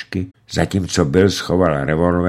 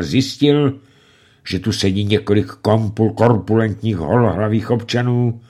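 plain reading voice

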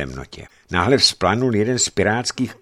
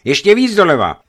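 high, loud command; urgent shouted order; 11 semitones higher, 11 dB louder than usual. A narrator's reading. Captain sees the beams ahead and shouts to steer harder.

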